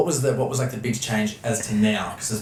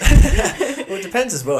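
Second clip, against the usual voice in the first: starts much higher, laughing through the words; 12 semitones higher, 8 dB louder than usual. Real, not acted. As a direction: laugh as you begin to answer. Laughs while answering.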